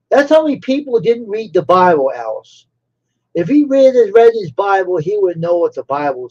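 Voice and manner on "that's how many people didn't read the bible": ordinary delivery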